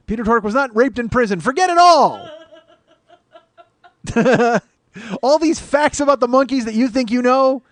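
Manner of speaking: shouted mock proclamation, laughing through it; hammed-up rant. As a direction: bellow it like a carnival barker with a grin. Mock rant continues after laugh.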